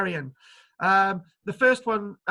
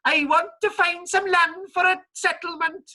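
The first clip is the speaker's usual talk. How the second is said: high, hammed-up put-on accent; Patterson voice; 9 semitones higher, 5 dB louder than usual. Patterson asking pirates for settlement land, high mock accent. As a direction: play the unsure founder in a silly accent. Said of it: "it's with Patterson".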